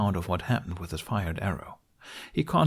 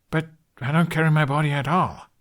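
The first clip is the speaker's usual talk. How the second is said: arguing, confused; 6 semitones higher, 5 dB louder than usual. A narrator's reading. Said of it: "Hiccup argued, genuinely confused".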